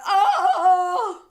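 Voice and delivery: loud high-pitched yell; imitated scream. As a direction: let out a mock scream. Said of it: "Kevin decides to scream with her".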